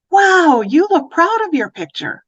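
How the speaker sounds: high, loud, delighted "Wow"; delighted praise. Shown as a demonstration. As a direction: gush over a child's drawing. Demo praise to child.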